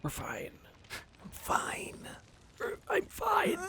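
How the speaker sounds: breathless unvoiced whisper. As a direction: whisper it hoarsely. Joking whisper under attack.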